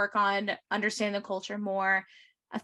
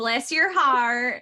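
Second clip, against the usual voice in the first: very slow, loud Southern "Bless your heart"; Southern accent; 2 semitones higher, 5 dB louder, much slower than usual. Pretend. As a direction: draw it out like a Southern lady. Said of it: "Is the Southern accent necessary?".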